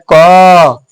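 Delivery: slow, each sound held out; "क" drawn out slowly from the throat; slow demo of क. Shown as a demonstration. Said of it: "खड़ा हो जा रहा है".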